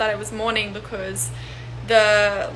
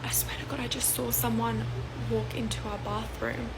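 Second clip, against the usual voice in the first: hushed, breath-only start, staying very soft; whispering; 7 dB quieter than usual. Retold or imitated. Mum telling daughter of intruder in bathroom, whispering. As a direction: whisper a frightening report. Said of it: "like, whispering something to me".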